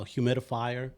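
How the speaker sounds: ordinary delivery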